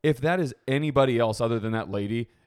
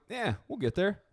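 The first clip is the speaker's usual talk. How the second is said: higher, soft encouraging tone; reassuring voice; 9 semitones higher, 4 dB quieter than usual. Retold or imitated. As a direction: coax her along gently. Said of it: "And I'm like".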